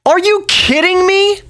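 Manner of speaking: very high-pitched disbelief; incredulous outburst. Real, not acted. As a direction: exclaim it in high, squeaky disbelief. Disbelief at the accent ranking.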